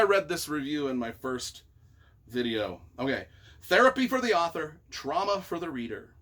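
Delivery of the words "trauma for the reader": usual speaking voice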